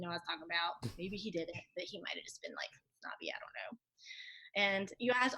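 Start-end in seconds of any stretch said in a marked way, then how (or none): 1.8-3.8 hushed whisper, almost no voice; whispered aside. Real, not acted. Whispering a snide aside to the interviewer. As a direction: drop to a secret whisper for the aside.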